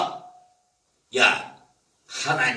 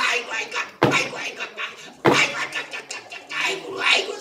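fast breathy cries of aigo; pained wailing; 9 semitones higher, 5 dB quieter, much faster than usual. Acted. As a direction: yelp under each blow. Beggar being beaten.

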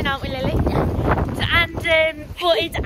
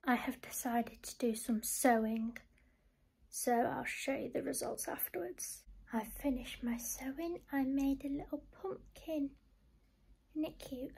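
soft whisper; 15 dB quieter than usual. Real, not acted. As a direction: whisper it quietly. Unvoiced whisper.

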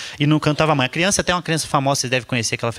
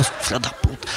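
soft, low muttered swearing; muttered curse; 5 semitones lower, 6 dB quieter, slower than usual. Retold or imitated. Comedian cursing the kid under his breath, soft mutter.

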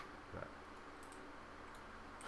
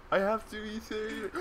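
laughing; 10 semitones higher than usual. Real, not acted. Laughing through panic in game.

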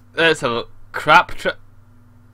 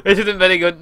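normal talking voice

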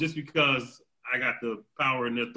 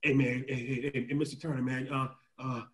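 low, soft, stammering mock request; halting imitation of a humble colonel; imitating hesitant colonel; 4 semitones lower, 6 dB quieter than usual. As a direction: play a colonel sheepishly asking a favor. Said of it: "lieutenant colonels come to my office and be like".

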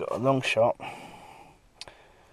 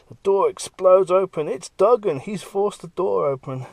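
higher and much louder, thrilled; excited burst; 9 semitones higher, 10 dB louder than usual. A narrator's reading. Artist pitching the breakout, loud thrilled narration. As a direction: blast it out like the big action beat.

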